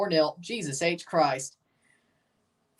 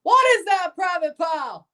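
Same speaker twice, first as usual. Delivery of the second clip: drill sergeant; 10 semitones higher than usual. Pretend. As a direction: tear into the recruit. Acting a drill sergeant scene.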